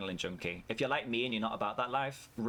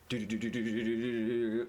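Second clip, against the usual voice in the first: imitated ride rattle. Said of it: "like that".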